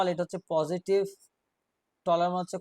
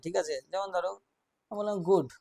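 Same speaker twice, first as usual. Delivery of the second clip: ordinary delivery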